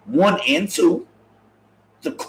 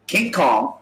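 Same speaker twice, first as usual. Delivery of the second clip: ordinary talk, nothing marked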